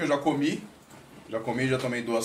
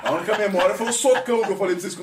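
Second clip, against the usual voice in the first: laughing; 6 semitones higher, 6 dB louder than usual. Real, not acted. Laughing about the punch.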